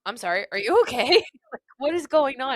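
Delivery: loud, high burst of mock alarm; hammed-up worried questioning; retold alarmed concern. Retold alarm at finding her crying.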